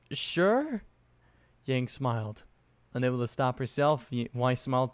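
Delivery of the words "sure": hesitant high questioning; unsure. A narrator's reading. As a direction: answer unsure, pitch rising. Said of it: "not sure whether to take that".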